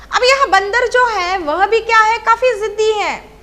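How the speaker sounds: ordinary talk, nothing marked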